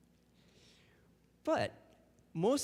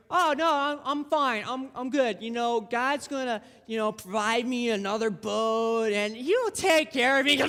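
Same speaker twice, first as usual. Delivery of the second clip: mock cheerful reassurance, pitched up; breezy denial; 4 semitones higher, 3 dB louder than usual. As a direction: call back that everything is fine. Acts swimmer refusing rescue.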